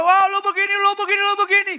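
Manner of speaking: high, loud sneering accuser; mocking devil's jeer; taunting devil. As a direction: needle them with their past.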